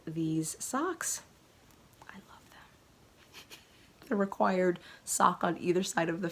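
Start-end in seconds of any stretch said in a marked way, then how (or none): 2.1-4.1 slow breathy whisper. Real, not acted. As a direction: whisper it adoringly. Adoring socks whisper.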